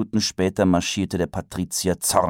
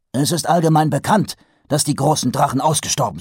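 brusque; 10 semitones higher, 5 dB louder, faster than usual. A narrator's reading. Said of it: "stellte der Patrizier brüsk fest".

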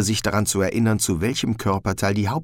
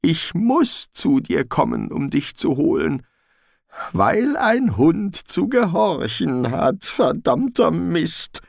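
tragic heroine sorrow; 11 semitones higher, 3 dB louder, slower than usual. Acted. Frightened dog speaks.

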